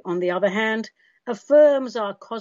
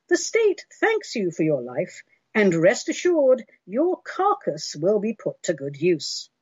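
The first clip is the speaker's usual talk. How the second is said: dystopian announcer. Pretend. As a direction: read it like a cold state robocall. Mock state robocall message.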